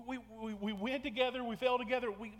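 normal talking voice

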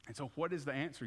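normal talking voice

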